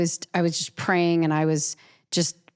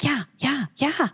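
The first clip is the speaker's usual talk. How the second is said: high, quick eager yeses; 8 semitones higher than usual. Retold or imitated. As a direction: jump at the deal. Eager agreement to offer.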